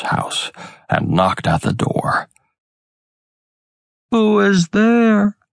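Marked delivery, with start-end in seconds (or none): 4.1-5.4 put-on higher, slow, frail old-woman call; old grandmother. A narrator's reading. Sick grandmother calling to the door, high and slow. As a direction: call out weakly from a sickbed.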